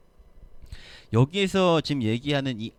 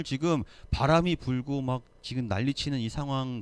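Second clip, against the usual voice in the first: ordinary talk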